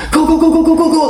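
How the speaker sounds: high, frantic go-go-go; high mock panic; frantic urging. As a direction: mimic her panicking the driver on. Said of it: "She like that".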